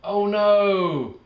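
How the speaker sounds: high, loud storybook gasp of alarm; excited exclamation. A narrator's reading. Narrator reading a spill to kids, high and loud. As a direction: squeal the oh no.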